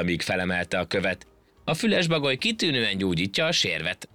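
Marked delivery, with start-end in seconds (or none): 1.6-4.1 cheeky. A narrator's reading. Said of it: "szemtelenkedett a hangya".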